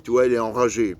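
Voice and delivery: usual speaking voice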